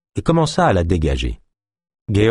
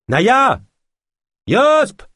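shouting names; 4 dB louder than usual. A narrator's reading. Calling out for friends.

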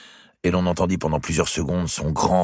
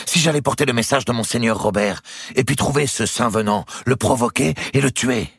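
higher, excited scheming; fired-up young lover; 10 semitones higher, 4 dB louder than usual. Acted. Nephew proposing to kill his rival, fired up. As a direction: propose it in a rush of inspiration.

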